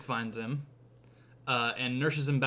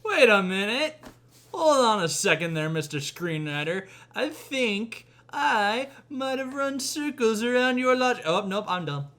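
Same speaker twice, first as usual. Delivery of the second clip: higher, louder, pompous gotcha; mock smug challenge; 9 semitones higher, 5 dB louder than usual. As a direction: lecture the screenwriter in triumph.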